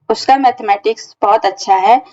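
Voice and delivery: ordinary talk, nothing marked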